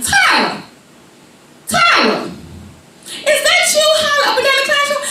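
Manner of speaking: mock stern teacher shouting down hall; teacher calling. Teacher calling Tara in the hallway, high.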